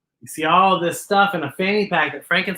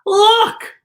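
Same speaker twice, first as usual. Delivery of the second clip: excited exclamation; 11 semitones higher, 5 dB louder than usual. Real, not acted. Exclaim to kids.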